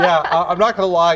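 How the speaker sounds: laughing while talking. Real, not acted. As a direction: talk through laughter. Laughing after bad accent.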